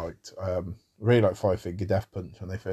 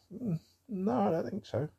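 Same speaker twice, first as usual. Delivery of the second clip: dismissive; 13 semitones higher, 4 dB quieter than usual. Real, not acted. Rebutting imagined critic.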